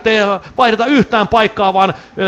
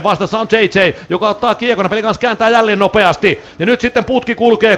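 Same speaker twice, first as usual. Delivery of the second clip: normal talking voice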